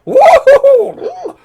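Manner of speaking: very high, loud, slow mock whoop with laughter; squeaky cheer. Mocking the good news, squeaky laughing whoop. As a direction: whoop like a giddy little creature.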